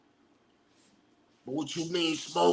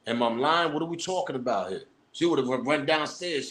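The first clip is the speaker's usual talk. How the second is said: ordinary delivery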